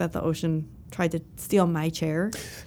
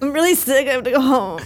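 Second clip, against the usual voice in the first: higher, louder mock sick plea; fake sick voice; 6 semitones higher, 6 dB louder than usual. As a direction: ham up being ill. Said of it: "it's my fake cough".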